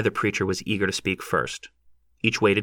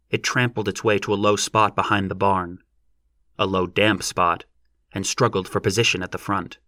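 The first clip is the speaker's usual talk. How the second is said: ordinary delivery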